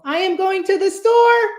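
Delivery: really high voice. Shown as a demonstration. Speaker showing the top of the vocal range, very high voice. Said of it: "make your voice really high".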